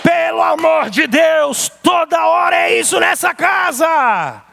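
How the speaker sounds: exasperated shout at the family; shouting instead of a gentle word; angry outburst. Retold or imitated. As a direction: yell it, fed up with the whole house. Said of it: "ao invés de você dar uma palavra branda".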